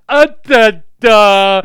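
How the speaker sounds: loud, high, strained wordless groans; mute invalid groaning. Retold or imitated. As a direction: groan like someone who can't speak. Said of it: "She's like".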